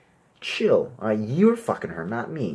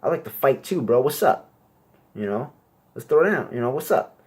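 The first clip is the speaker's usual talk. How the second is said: higher, louder tough-guy challenge; macho bro voice; 6 semitones higher, 4 dB louder than usual. Retold or imitated. Bald tattooed guy inviting a fight, higher and louder. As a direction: puff up like a bro ready to fight. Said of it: "He's like".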